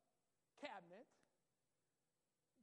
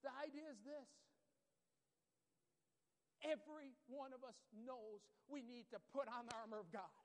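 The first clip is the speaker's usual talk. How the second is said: normal talking voice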